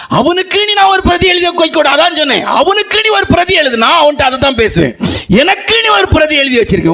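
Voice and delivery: high, ringing sermon proclamation; preaching at full force. Acted. Pastor to congregation about a young man's hair, high fervent preaching. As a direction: drive the point home to the church.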